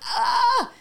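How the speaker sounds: high, loud re-enacted yelp; mock pained yelp. Patient retelling the mammogram squeeze, high mock cry of pain. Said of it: "and I'm like".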